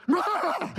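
laughing fake lion snarl; lioness roar. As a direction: roar like a mama lion guarding her cubs, half laughing. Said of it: "And she is like".

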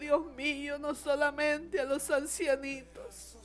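heartfelt plea with long pauses; sorrowful prayer. Real, not acted. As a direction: pray tenderly for the bereaved, letting phrases hang. Prays for grieving families, pausing.

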